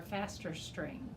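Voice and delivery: usual speaking voice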